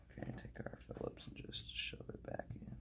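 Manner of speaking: soft unvoiced whisper. Real, not acted. Reattaching the screw, whispering to himself. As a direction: murmur instructions in a whisper.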